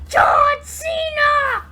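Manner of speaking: very high, very loud, slow screamed war cry; battle cry. Acted. Kid yelling a wrestler's name as a battle cry, slow scream. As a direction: scream it with everything you have.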